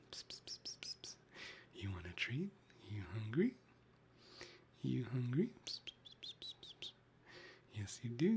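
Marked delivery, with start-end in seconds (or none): none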